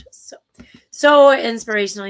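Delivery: usual speaking voice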